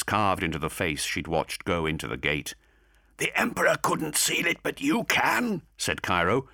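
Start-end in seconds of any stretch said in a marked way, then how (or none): 3.2-5.6 higher, distinct character voice; Cairo's voice. A narrator's reading. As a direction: voice Cairo, measured.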